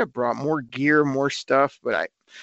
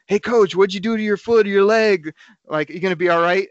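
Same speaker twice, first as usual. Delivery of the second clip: other fan's call; 5 semitones higher, 4 dB louder than usual. Retold or imitated. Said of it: "somebody goes".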